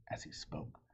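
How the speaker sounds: ordinary delivery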